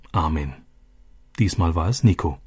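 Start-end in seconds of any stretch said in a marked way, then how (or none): 0.1-0.5 a soft, low "Amen." from deep inside; heartfelt. Acted. Nico, moved, answers the psalm from the heart, soft and low. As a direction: breathe "Amen." quietly from the heart. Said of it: "aus tiefstem Herzen".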